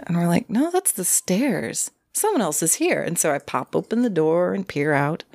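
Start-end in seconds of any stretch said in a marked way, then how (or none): 0.5-3.0 slow, high-pitched mock alarm; alarmed realization. Two girls in bed hearing someone outside, alarmed. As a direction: imitate a dawning, uneasy certainty. Retold alarm at creaking stairs.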